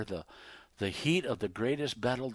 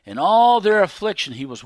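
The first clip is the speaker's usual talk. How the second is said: very loud, high cry of the verse; shouted scripture line; calling out; 9 semitones higher, 16 dB louder than usual. Acted. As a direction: cry it out to the back row. Preacher calls out the verse.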